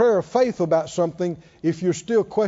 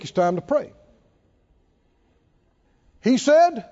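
normal talking voice

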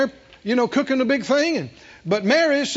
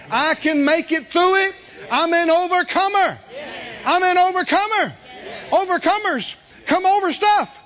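rising preaching; 7 semitones higher than usual. Real, not acted.

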